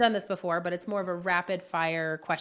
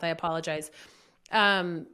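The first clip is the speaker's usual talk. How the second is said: usual speaking voice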